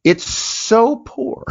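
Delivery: "so poor" dragged out very slow and high; exaggerated drawn-out "It's so poor"; stretched emphasis. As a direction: lean hard on "so" and stretch it. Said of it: "How poor?".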